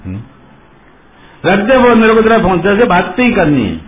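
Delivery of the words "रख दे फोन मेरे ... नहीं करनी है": high-pitched, acted angry outburst. Girlfriend rejects her boyfriend on the phone, angry. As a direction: refuse him crossly, pitch raised then falling. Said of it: "गुस्से में बोल रही है".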